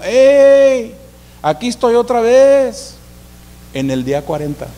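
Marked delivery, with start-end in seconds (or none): 0.0-3.0 loud high yell across the field; giant shouting. Retold or imitated. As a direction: call out like a giant taunting an army. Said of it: "un hombre gritando". (grito fuerte y agudo de gigante)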